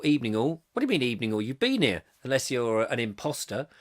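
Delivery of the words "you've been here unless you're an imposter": ordinary talk, nothing marked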